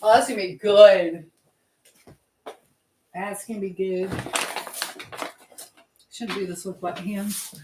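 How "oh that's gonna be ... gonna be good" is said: ordinary delivery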